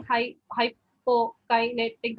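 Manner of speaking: "hypokinetic" split slowly syllable by syllable, hy-po-ki-ne-tic; broken syllables. Shown as a demonstration. Student demonstrating broken dysarthric speech to examiner, slow syllable by syllable. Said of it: "break it up into individual syllables".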